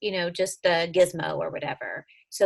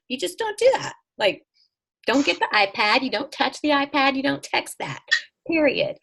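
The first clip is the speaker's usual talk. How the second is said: higher and louder, each "don't" pressed hard; firm insistence; 6 semitones higher, 6 dB louder than usual. Real, not acted. Mom telling her daughter the iPad rule, loud and firm. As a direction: lay down the law, no arguing.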